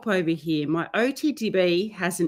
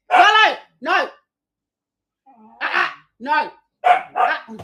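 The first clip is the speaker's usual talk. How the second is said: high warning call; sharp high uh-uh to stop her; scolding the puppy; 6 semitones higher, 8 dB louder than usual. Real, not acted. To Lulu bothering the old dog, a high scolding warning.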